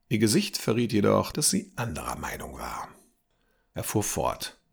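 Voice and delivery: plain reading voice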